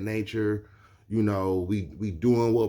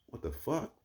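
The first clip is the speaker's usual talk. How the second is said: hushed, baffled aside with a rising end; soft, puzzled disbelief; surprised; 11 dB quieter than usual. Retold or imitated. Reacting to a stranger bursting in with energy, quietly surprised. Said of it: "Because I'm surprised".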